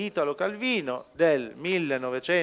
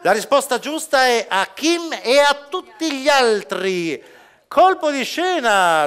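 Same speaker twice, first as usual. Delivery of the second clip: bright quiz host, a bit louder; showy game show host; 6 semitones higher, 4 dB louder than usual. Acted. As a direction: announce the answer with a big build-up. Announces the answer. (conduttore da quiz, rivela la risposta con enfasi)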